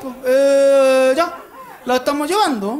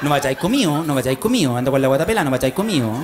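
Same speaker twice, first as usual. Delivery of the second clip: nagging mother; 8 semitones lower than usual. Retold or imitated. Mother voiced in story.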